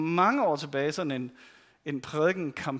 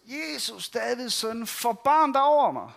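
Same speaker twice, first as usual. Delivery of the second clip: higher-pitched pleading call; 4 semitones higher, slower than usual. Retold or imitated. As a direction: call out to Jesus as he passes by. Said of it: "Og så råber han ellers". (bønfaldende råb)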